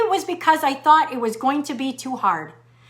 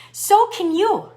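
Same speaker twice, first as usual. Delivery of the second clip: rallying; 5 semitones higher, slower than usual. Real, not acted. Rallying close to listeners.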